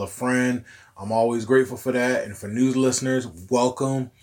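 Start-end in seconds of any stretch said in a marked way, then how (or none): none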